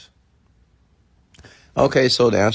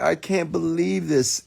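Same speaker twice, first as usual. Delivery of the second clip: high, drawn-out mock disbelief; imitated amazement; 9 semitones higher, slower than usual. Retells MC's amazed reaction.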